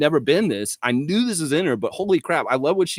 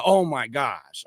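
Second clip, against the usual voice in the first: slow oh my gosh; 3 semitones lower, slower than usual. Real, not acted. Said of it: "slow exclaim".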